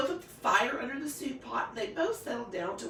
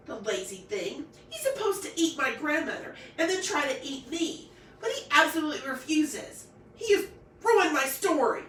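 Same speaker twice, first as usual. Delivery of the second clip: indignant complaint; 6 semitones higher, 5 dB louder than usual. Acted. Girl complains about the wolf.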